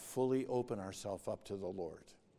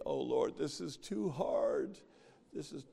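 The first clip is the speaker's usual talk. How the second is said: mock complaining prayer; 4 semitones higher than usual. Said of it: "Don't say".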